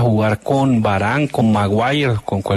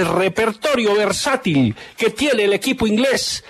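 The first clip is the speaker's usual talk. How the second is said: raised, ringing play-by-play goal call; excited goal commentary; 7 semitones higher than usual. Acted. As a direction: call the goal like a live radio narrator. Radio host calls a goal.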